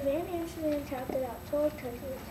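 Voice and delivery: ordinary delivery, nothing marked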